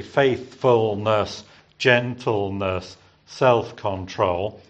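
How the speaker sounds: each word drawn out slowly, every syllable separate. Shown as a demonstration. Preacher modelling the third row for the congregation, slow and deliberate. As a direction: spell out the three syllables of faithfulness, gentleness, self-control slowly. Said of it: "All the words have three syllables".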